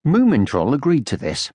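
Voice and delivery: ordinary delivery, nothing marked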